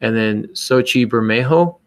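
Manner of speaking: normal talking voice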